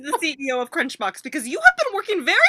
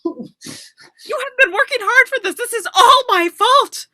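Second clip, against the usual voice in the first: slow, higher tearful self-blame; hammed-up weeping; 6 semitones higher, 4 dB louder, slower than usual. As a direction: mom wallowing in guilt. Mom blames herself tearfully.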